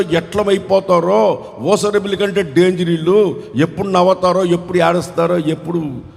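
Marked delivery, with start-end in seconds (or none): none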